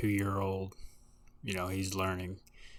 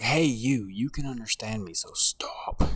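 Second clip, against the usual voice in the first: scolding; 3 semitones higher, 8 dB louder than usual. Retold or imitated. Scolding the oldest kid, retold.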